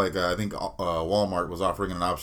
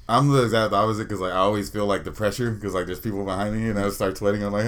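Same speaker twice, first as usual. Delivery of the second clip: ordinary talk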